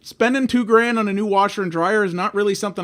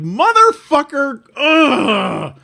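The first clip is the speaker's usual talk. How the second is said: much higher, slow, furious swear then groan; steaming mad; 10 semitones higher, 4 dB louder, much slower than usual. Retold or imitated. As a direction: drag it out, boiling over. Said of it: "I was just steaming mad".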